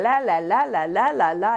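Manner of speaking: loud mock nagging singsong; mocking babble. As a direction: mimic a complainer's droning. Mocking someone's complaining.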